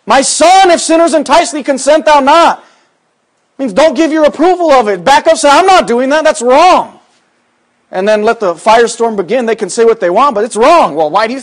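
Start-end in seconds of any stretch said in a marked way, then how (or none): 0.0-2.6 preached verse. Shown as a demonstration. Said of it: "verse repeated with force". 3.6-7.0 full-force revival sermon, climbing in pitch; fiery preaching. Acted.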